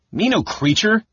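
sudden high indignant cry; the gully dwarf cuts him off, offended; high, loud protest from a small offended dwarf; indignant outburst. A narrator's reading. Bupu bursts out indignantly.

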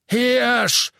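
high, loud roar of scorn; bellowing. A narrator's reading. Innkeeper rejecting the stag story, bellowing. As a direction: roar the word back. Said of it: "brüllte der Wirt".

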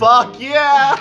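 loud, high laughing cheer; excited cheer. Real, not acted. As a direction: whoop with delight. Cheering a granted aspiration.

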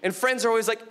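ordinary delivery

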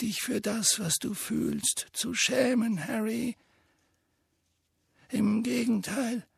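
high, soft, slow wise voice; gentle old headmaster. A narrator's reading. Dumbledore gently consoles grieving Harry, soft and slow. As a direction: comfort the boy, unhurried.

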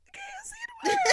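very high squeaky goodbye; starstruck squeal. Retold or imitated. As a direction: squeal it, about to burst out laughing. Retold starstruck goodbye, breaking into laughter.

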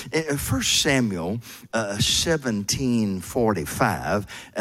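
normal talking voice

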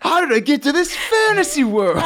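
high-pitched mock Boston athlete; baffled football star in broad Boston; Boston accent. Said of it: "Tom Brady doesn't have a Boston accent".

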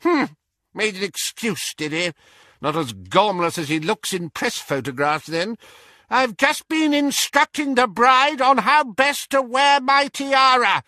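scoff, then build to a high, loud shout; shouting elderly witch. A narrator's reading. Great-aunt boasting of her tiara, high and shouting. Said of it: "she shouted at Harry".

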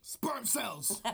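said through a laugh; chuckling it again. Real, not acted. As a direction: giggle out the answer. Repeats punchline laughing.

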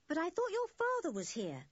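put-on high-pitched, puzzled boy. A narrator's reading. Dick asks Jock about his father, puzzled. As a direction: query it in confusion, pitched high. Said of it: "said Dick, puzzled".

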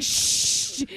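sharp hushing shush; imitated shush. Cohost hushing her laughter, imitated shush. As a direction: shush someone who is laughing. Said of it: "He's like".

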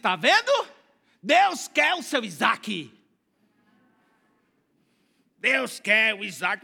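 slow, scoffing imitation with a laugh; mocking quote. Preacher mocking those who say God wants your Isaac, scoffing. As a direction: mimic the naysayers, slowly. Mocking what some people say.

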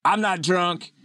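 high, slow tipsy slur; drunk voice. Pretend. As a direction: slur it like a denial. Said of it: "what every drunk says".

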